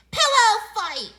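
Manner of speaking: high, drawn-out, very loud yell; excited scream. A narrator's reading. Boy starting a pillow fight with friends, excited scream. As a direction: scream it out as a battle cry. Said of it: "screamed Buster".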